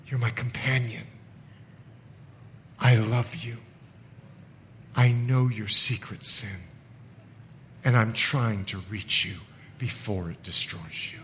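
hushed loving appeal; tender Jesus. Acted. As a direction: plead gently with a friend who betrays you. Jesus speaks tenderly to Judas.

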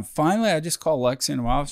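ordinary delivery

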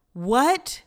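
high, loud shocked what; a real jolt of disbelief. Real, not acted. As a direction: blurt it out in disbelief. Shocked at grandpa's dialysis.

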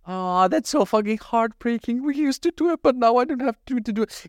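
mock heartbreak. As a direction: overdo the sympathy, pitch way up. Mock heartbreak at friend's confession.

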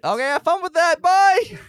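high, loud shout on the run; shouted farewell. Acted. Player flees as golem tears the train, high shouted farewell. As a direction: yell a hasty goodbye as you bolt.

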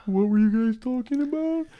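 very high cartoon dog voice; voicing the dopey little dog; mock dog voice. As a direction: squeak it like a silly dog. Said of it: "He doesn't talk like that".

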